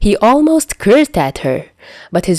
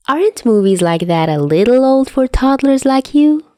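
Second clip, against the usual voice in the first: taunting; 7 semitones higher than usual. A narrator's reading. Dazai taunting Chuya.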